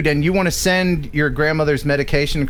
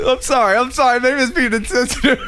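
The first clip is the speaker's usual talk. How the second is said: laughing apology; 9 semitones higher than usual. Real, not acted.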